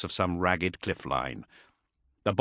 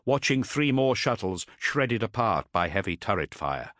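plain reading voice

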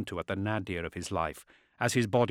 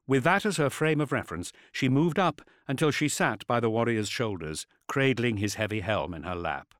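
plain reading voice

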